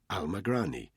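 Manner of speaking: plain reading voice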